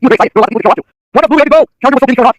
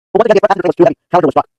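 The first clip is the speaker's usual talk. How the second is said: ordinary delivery, nothing marked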